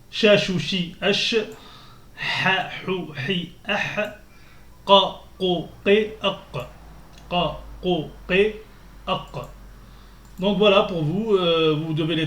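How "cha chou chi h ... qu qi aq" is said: each syllable said slowly and clearly, one by one; Arabic letter sounds. Shown as a demonstration. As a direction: model the letter sounds for learners. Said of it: "Je répète, pour les travailler".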